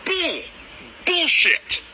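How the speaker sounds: high, sharp angry cry; angry outburst. Real, not acted. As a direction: spit it out in anger. Furious rant at agencies.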